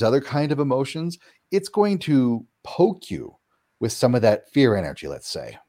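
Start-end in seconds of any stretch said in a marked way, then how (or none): none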